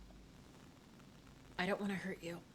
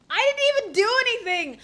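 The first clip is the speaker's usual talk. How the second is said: high loud protest; outraged yelp; indignant yelp; 11 semitones higher, 9 dB louder than usual. Real, not acted. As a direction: protest loudly. Protesting game outcome.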